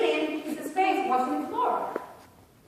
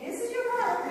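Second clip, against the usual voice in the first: high, patient teacher voice; teacher guiding child; 11 semitones higher, faster than usual. Retold or imitated. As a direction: point it out to a small child, pitched up. Teacher instructs little girl.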